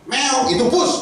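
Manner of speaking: normal talking voice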